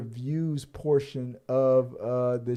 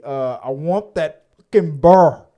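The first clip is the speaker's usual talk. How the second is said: put-on loud Southern accent, burr for bear; 2 semitones higher, 8 dB louder than usual. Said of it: "very deep Southern accent".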